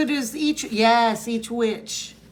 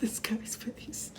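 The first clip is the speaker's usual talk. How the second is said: whisper; 10 dB quieter, slower than usual. Real, not acted. Aside whispered.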